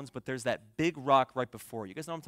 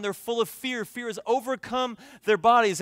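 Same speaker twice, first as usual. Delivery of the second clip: usual speaking voice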